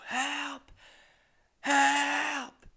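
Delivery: high-pitched desperate yell from a nightmare; yelling for help. Acted. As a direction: cry out HELP, second one bigger. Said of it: "That's when I yell. I go".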